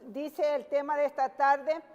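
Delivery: ordinary delivery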